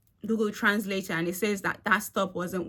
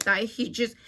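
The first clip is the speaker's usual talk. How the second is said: normal talking voice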